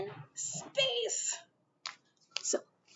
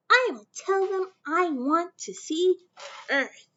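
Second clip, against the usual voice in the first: put-on higher, louder little girl voice; little Mae; 5 semitones higher, 4 dB louder than usual. Young Mae telling her mother her dream, in a higher child voice. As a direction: play a hopeful young girl.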